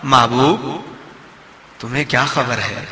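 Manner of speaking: low, softened address to a beloved; gentle and affectionate; loving reading. Shown as a demonstration. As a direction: drop the voice tenderly, as if honouring the beloved. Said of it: "تو یوں کیوں نہیں کہتا".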